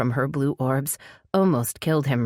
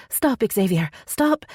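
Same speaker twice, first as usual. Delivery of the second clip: urgent, high-pitched pleas to stop; panicked heroine, pitch jumping high; begging; 10 semitones higher than usual. A narrator's reading. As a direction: beg desperately for it to end. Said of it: "she begged".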